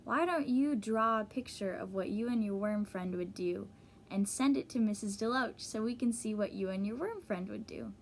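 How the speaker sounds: plain reading voice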